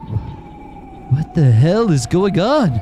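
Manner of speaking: panicked outburst. Acted. Trapped girl panics at locked door.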